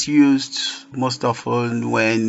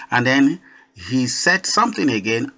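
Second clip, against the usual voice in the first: ordinary talk, nothing marked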